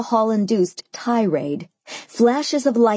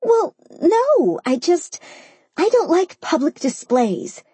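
higher, flustered protest; flustered, halting objection, pitched up; sputtering; 8 semitones higher than usual. A narrator's reading. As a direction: sputter a defensive denial. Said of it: "she sputtered".

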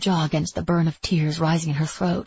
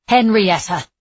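louder, insistent call of the name; sharp call; 3 semitones higher, 7 dB louder, slower than usual. A narrator's reading. Alice tries to get Etta's attention, calling out loudly. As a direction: call out to stop someone not listening. Calling after her in a quarrel.

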